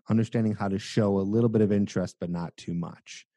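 normal talking voice

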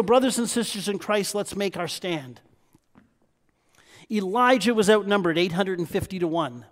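usual speaking voice

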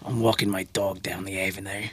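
put-on Australian outback accent, meant to be Statham; Crocodile Dundee accent. Host attempts Statham but lands on an Australian Dundee accent. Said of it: "That was fucking Crocodile Dundee".

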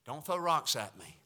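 sheepish hushed aside; quiet joke. Real, not acted. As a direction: mumble it as a joke. Joking aside after rebuke.

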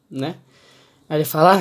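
ordinary talk, nothing marked

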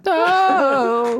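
high, far-off mock yelp; imitated yelp. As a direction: copy the yelp back. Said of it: "That was a cool sound".

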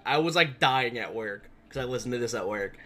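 ordinary delivery

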